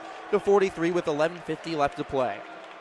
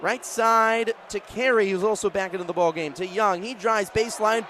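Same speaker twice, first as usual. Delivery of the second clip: ordinary talk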